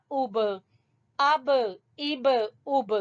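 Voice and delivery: each اب slow, with a bounced final b; qalqalah. Shown as a demonstration. Teacher drilling the syllables slowly, qalqalah bounce on اب. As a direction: release every b in اب with a short echo. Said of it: "یہ قلقلہ ہے".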